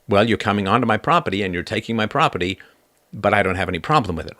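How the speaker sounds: ordinary talk, nothing marked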